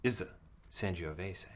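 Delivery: game master recapping last session; story recap narration. Acted. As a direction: set the scene for the players.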